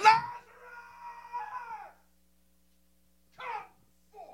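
undignified loud cry. Acted. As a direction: cry out as if summoning the dead. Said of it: "And he cried out".

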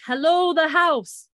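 loud, high drawn-out hail; calling out. Retold or imitated. Roger hailing a strange house, calling out. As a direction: holler it across to the house.

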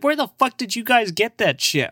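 high-pitched hammed-up demand; mock indignant self. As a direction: squeal the question, playfully offended. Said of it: "I was like".

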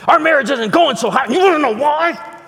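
high, loud indignant whine; hammed-up angry spouse; mock complaining spouse. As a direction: play up the spouse's outrage. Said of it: "come up to me and go".

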